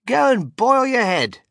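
high, loud dismissive insult; cutting retort. A narrator's reading. Wonka rudely dismissing Missus Salt, cutting retort. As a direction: throw the insult back, high and forceful.

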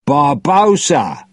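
drawn-out, high-pitched cry of a name; high, stretched-out call; loud shout. A narrator's reading. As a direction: shout the name slowly at an old enemy. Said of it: "he shouted loudly".

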